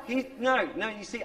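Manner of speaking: normal talking voice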